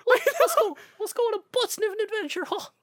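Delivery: very high, fast squeal; hammed-up cartoon hero; high squeaky mock adventurer. Kingdom Hearts voices bit.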